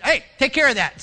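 a boss snapping at staff; curt boss order. Retold or imitated. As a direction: bark it down the hallway.

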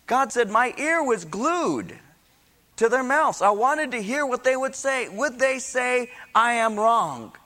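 high, ringing scripture proclamation; impassioned preaching. Real, not acted. Preacher voicing the Lord's complaint to the congregation, impassioned.

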